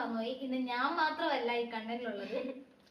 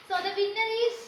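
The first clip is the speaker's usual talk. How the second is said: higher, louder winner reveal; excited announcement; 7 semitones higher, 4 dB louder, slower than usual. Real, not acted.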